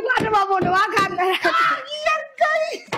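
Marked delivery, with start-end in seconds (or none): 1.4-2.9 high shocked shriek, God; frightened shriek. Real, not acted. Shocked by the sum.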